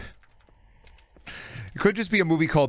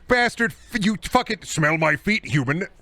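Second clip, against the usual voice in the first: raging yell; 9 semitones higher than usual. Real, not acted. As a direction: lose it at the enemy. Raging at enemy player.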